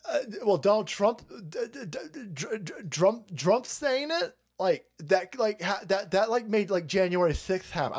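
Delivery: high-pitched, sputtering caricature; mock flustered stammering. Critics blaming Trump for January sixth, mock flustered stammering. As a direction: trip over the first sounds, pitched well up, struggling to get the accusation out.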